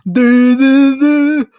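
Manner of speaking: loud, high honking horn noise; imitated bus horn. Streamer mocking the school bus, loud imitated horn. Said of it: "that's the horn".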